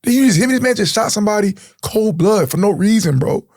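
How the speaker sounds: heated refusal. Retold or imitated. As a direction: refuse and shout over him. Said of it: "He's like".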